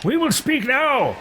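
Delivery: high, slow imperious shout; demanding game character. Acted. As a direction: order them in a hammed-up high voice. Character demands talk after being dismissed.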